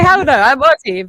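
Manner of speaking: mock outraged. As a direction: belt it out as a proud Aussie. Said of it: "was like".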